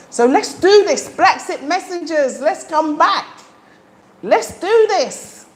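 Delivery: rally the audience like a cheerleader; real thrill, calling the messengers back; excited rallying cry. Real, not acted. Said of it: "Sorry, I'm so excited".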